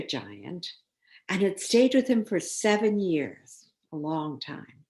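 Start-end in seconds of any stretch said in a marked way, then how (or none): none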